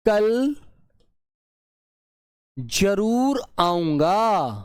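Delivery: जरूर drawn out, spoken slowly; teacher lingering on the underlined word जरूर; very slow stress. Shown as a demonstration. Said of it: "underline करके उसने क्या दे दिया".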